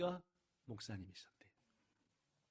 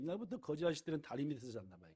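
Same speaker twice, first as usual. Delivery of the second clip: normal talking voice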